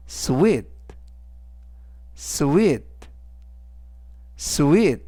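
sweet said slowly and clearly; sweet, modelled for learners; model pronunciation. Shown as a demonstration. Teacher modelling sweet for students, clear. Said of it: "라고 발음하시면 되겠고요".

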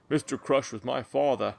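put-on a playful Australian accent on a movie-style line; a hammy Australian accent, lightly done; mock Australian accent. Beer reviewer riffing on the beer name, mock Australian accent. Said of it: "UK accent instead of an Australia one".

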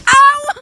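loud pained yelp; sudden cry of pain; sharp ouch. Real, not acted.

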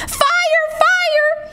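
very high mock alarm; false alarm, pitched right up; cry of alarm. Jack tricking the birds, high alarm cry. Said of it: "he cried".

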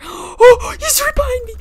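startled high-pitched scream; scared. Real, not acted. Gamer startled by enemy right behind, scared shriek. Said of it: "I'm kinda scared".

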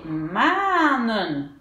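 MANNEN avec un A très étiré, «maa-nen»; A long. Shown as a demonstration. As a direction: allonger fortement le A de MANNEN. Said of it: "je prononce comme un long A". (MANNEN, première voyelle longue)

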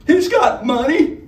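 eager betrayal. Acted. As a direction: blurt it out to save herself. Honey Bell betrays Matthew.

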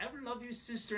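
hammed-up tender sibling; mock affection. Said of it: "if I did that to my sister".

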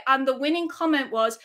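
ordinary delivery

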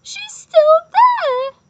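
very high, slow, loud squeal; squeaky baby bear. A narrator's reading. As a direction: squeal it out as a tiny cub. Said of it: "squeaked Baby Bear".

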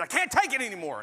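high, loud cry of giving up; exasperated outburst. Retold or imitated. Mark quitting on Paul, a loud exasperated outcry. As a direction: blurt it out like a man at the end of his rope.

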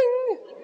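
high bell ding; high ding chime. Retold or imitated. Ding then laugh.

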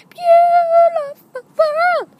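very high, loud, stretched yell; silly high-pitched whoop; wild exclamation. Acted. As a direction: holler it slowly at the top of the voice. Wild antics while sitting.

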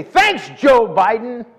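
loud, drawn-out mock gratitude; sarcastic thanks. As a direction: thank them with exaggerated sarcasm. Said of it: "say thanks in a sarcastic way".

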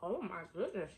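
talking with mouthful; mid-bite mumbling; chewing food. Real, not acted. Seen 0: Bit taco earlier; jaw and cheeks show chewing while speaking.

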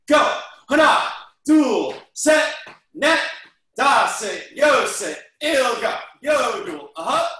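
loud rhythmic counting shouts; shouted count. Real, not acted. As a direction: call each count out loud. Counting jumping jacks aloud.

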